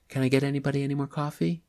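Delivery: chirpy waitress pouring coffee. Acted. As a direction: breeze past the table offering coffee.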